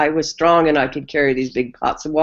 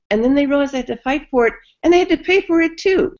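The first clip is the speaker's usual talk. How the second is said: much higher and fast, building up; rising indignation; 11 semitones higher than usual. Real, not acted. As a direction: pile it on, climbing in outrage. The point restated, building indignation.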